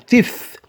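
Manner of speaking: "Teeth" with the tongue between the teeth, pitched high; pronunciation demo. Shown as a demonstration. Teacher shows learners how to say teeth. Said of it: "Tu mets la langue entre les dents".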